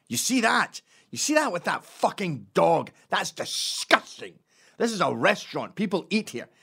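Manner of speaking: loud, high outrage at a stranger; dad yelling. Acted. Dad rebuking a dog owner in a bar, yelling. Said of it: "don't fucking start yelling at people in bars".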